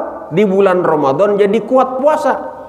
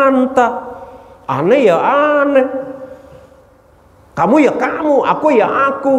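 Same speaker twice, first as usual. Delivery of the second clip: defiant insolent reply; 9 semitones higher than usual. Acted. Nafsu sneering back at Allah, high and cocky. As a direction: answer God back with smug defiance.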